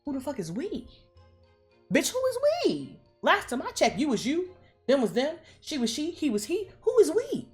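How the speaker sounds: high-pitched, incredulous challenge; hammed-up high indignant questioning; indignant disbelief. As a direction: voice swooping way up in mock outrage. Acting out indignant in-laws questioning "we.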